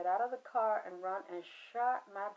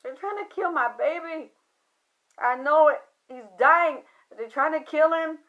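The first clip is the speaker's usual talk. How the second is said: retold crying; 6 semitones higher, 11 dB louder than usual. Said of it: "Why are you crying?".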